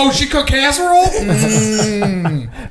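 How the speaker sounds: loud, high, laughing delight; mock swooning. Teased man playing keen on a friend's aunt, mock swooning. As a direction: squeal it with hammed-up appetite, ending on a relishing mm. Hamming up attraction to aunts.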